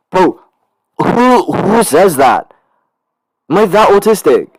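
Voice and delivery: loud, high self-mocking wail; incredulous outburst. Real, not acted. Student cringing at their own reply, incredulous shout. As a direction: cry out in disbelief at yourself.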